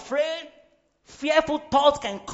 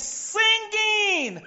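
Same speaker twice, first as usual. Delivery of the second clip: exclaimed word; 9 semitones higher, 5 dB louder than usual. Real, not acted. Preacher proclaims the word.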